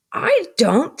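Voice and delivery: 甲高い声で叱りつける姉; high, sharp scolding sister's voice; scolding older sister. Acted.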